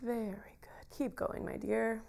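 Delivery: hushed spa whisper, very soft. Acted. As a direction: murmur praise like a calm healer. Healer praising, whispered.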